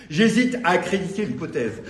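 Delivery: poem line projected in full voice; projected poetry recital; full-voiced declamation. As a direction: take up "J'hésite" firmly and declaim onward. Same words restarted in declaimed voice.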